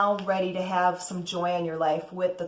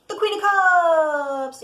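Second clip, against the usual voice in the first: dramatic reveal; 9 semitones higher, 7 dB louder, much slower than usual. Real, not acted. Dramatic reveal of tarot card.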